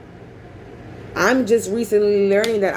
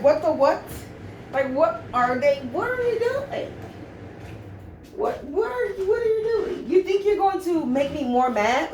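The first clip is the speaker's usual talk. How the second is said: high, quiet, half-laughing mock disbelief; incredulous disbelief; 7 semitones higher, 5 dB quieter than usual. To the stomping upstairs neighbors, high incredulous mock disbelief. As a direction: retell your bewildered reaction to the stomping neighbors, pitched way up. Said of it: "I'm like".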